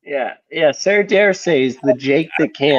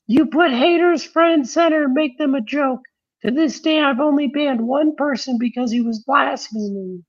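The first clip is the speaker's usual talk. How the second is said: much higher-pitched reading voice; put-on high voice; 10 semitones higher than usual. Host reading out advice on haters, put-on high voice. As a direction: read it out in a silly high voice.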